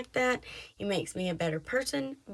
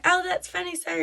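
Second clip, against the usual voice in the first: higher, forced polite brush-off; nervous polite laugh-off; 9 semitones higher, 4 dB louder than usual. Retold or imitated. Receptionist brushing off the crude joke, light forced politeness. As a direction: lightly imitate an embarrassed receptionist. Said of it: "she's like".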